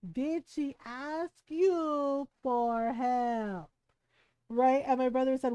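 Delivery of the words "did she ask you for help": very slow, drawn out word by word; deliberate Filipino mom. Retold or imitated. Mom pressing her son on the question, slow and deliberate. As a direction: stretch each word like a pointed mom. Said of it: "in her little Filipino mom way".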